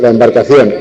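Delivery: normal talking voice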